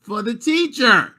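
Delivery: high, loud outrage; indignant parent. Retold or imitated. Parent refusing to buy the teacher lotion, loud and high. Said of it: "I'm like".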